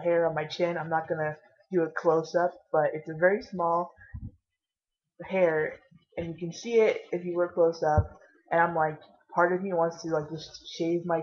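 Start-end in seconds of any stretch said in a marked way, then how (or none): none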